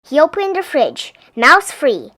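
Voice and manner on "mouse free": plain reading voice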